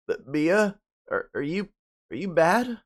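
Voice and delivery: a touch of wounded bewilderment; injured boy asks Mia, confused and hurt. Acted.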